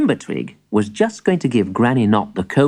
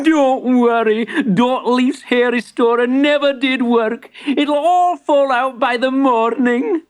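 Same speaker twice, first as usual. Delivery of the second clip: high, laughing shopkeeper voice; laugh, then reassure in a high character voice; tickled, high-pitched storybook shopkeeper; amused reassurance; 11 semitones higher than usual. Acted. Misfit laughing at Granny's hairy nose.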